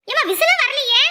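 put-on high, loud, nagging older woman; nagging mother-in-law. Mother-in-law scolding daughter-in-law over the burning stove, high and loud. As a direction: scold her about the burning pot.

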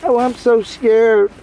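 slow, high mocking squeal of fake fright; mock scared. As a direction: pretend to be terrified, mockingly. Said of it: "Like I supposed to have been like".

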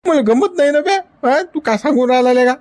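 put-on old man bristling at his friend's advice; irritated protest. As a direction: snap back at the friend.

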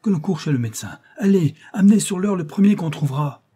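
loud, high-pitched urgent orders; furious. A narrator's reading. Colonel sending for the doctor, furious. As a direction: shout commands in a fury. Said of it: "s'écria le colonel en fureur".

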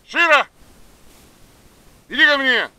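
very high, loud call to a dog. Real, not acted. Calling dog from distance.

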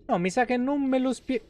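normal talking voice

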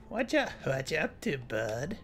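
put-on low drawling old man; grizzled groundskeeper voice; old guy voice. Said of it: "good old guy voice".

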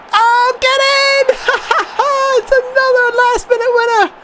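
very high, stretched-out cry of joy with a laugh; overjoyed shriek. Real, not acted. Gamer celebrating a last-minute winner, shrieking joy. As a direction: lose it completely at a late winner.

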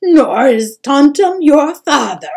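loud, anguished, weeping mother; tearful. A narrator's reading. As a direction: break down while telling a painful truth. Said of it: "looked at the boy tearfully".

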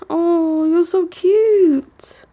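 loud, drawn-out high coo at a pet; high-pitched dog talk. Shown as a demonstration. Showing how owners talk to dogs, loud high-pitched cooing. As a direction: gush high and slow, stretching the words. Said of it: "they do this really high-pitched".

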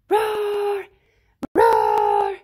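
huge high shouted roar; mighty roar. Acted. Allosaur finding its roar at last, huge shouted roar. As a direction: blast it out triumphantly.

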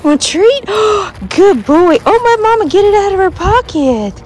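high-pitched coaxing of a dog; excited pet talk. Real, not acted. Dog mom fishing a treat from her pocket, excited pet talk. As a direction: coo to the dog as a treat comes out.

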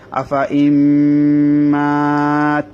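"আফা" short, "ইম্মাত" held long and slow; correct recitation. Shown as a demonstration. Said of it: "এখানে পড়া হবে".

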